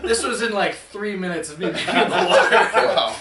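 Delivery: can't hold the laugh in. Real, not acted. Telling friends his snorkel disaster, laughing. Laughing through story climax.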